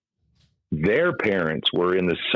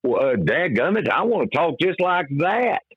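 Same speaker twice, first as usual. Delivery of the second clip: much higher, eager hillbilly "dadgummit"; a country boy blurting out his dream in a backwoods accent; 10 semitones higher than usual. Retold or imitated. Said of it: "backwood hillbilly accent".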